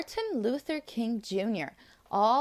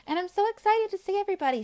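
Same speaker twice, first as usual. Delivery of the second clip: excited; 9 semitones higher, faster than usual. Real, not acted. Teacher signs off to preschoolers.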